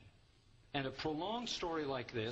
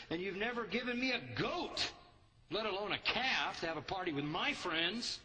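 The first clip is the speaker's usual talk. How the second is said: pent-up angry son; 8 semitones higher than usual. Acted. Older son venting resentment at his father, angry and hostile. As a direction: vent years of resentment at the father. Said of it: "Boy, he's really pent up, angry, hostile".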